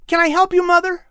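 put-on high, bright young boy's voice; a keen son offering to help; boy asking his mother eagerly; eager boy Patrick.

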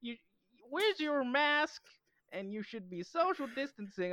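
very high, hammed-up scolding voice; mock Karen. Karen scolding a diner about a mask, mock scolding. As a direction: imitate an officious complainer in falsetto. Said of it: "all those Karens online doing that stuff".

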